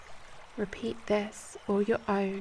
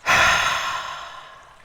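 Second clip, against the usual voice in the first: long, audible breathy exhale; demonstrative deep sigh out; 10 dB louder than usual. Shown as a demonstration. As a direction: let out a big releasing sigh. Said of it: "sighing out".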